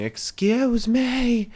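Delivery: high, very slow drawn-out shriek; mock Vickie yell. Podcaster imitating Vickie Guerrero yelling at the crowd. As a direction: stretch out excuse me like a heel manager.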